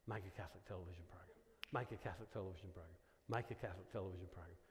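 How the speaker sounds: a nagging voice at night, low and quick; insistent inner voice. Retold or imitated. Speaker recalling God's voice at night, low soft quick murmur. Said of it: "I'd hear this voice".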